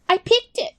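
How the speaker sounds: easy, breezy yes; high little rat voice; chirpy rat. Acted.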